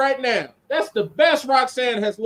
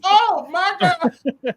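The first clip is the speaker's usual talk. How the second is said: higher, drawn-out oh my God; slow mock gasp; 7 semitones higher, much slower than usual. Co-host reacts to the professor's retort, drawn-out mock shock. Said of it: "I'm like".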